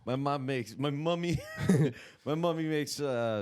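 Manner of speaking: put-on childish high voice about mummy; Luca Ferro impression. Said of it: "Do you like my Luca Ferro impression?".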